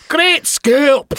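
high put-on Scottish voice; hammed-up Scottish accent; mock Scots accent. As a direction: say it like a comic Scotsman. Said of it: "a Scots accent".

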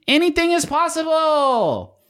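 high, drawn-out announcer yell; commercial yell. Pretend. Host selling the ad, yelling the slogan. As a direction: belt it like a Super Bowl ad. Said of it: "That was my voice yelling".

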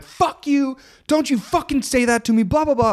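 high-pitched yell, held back in volume; retold yell. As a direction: re-enact blowing up at someone. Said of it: "I yelled out, I'm like".

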